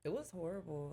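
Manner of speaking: softly spoken, away from the microphone; quiet first attempt, not projected; off the mic. Real, not acted. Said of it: "Talking to the mic".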